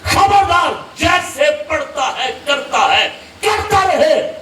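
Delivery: preacher voicing a stern warning to Umar; stern rebuke. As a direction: raise the voice in righteous anger. Prophet rebukes Umar in story.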